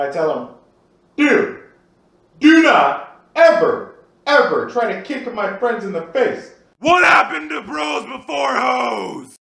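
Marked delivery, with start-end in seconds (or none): 1.2-6.5 much higher and louder, slow on do not ever; angry warning. Retold or imitated. [name] yelling at his friend after throwing him down. 6.8-9.3 slow, high wailing cry; crying shout. Retold or imitated. Friend on the ground crying out at [name], wailing.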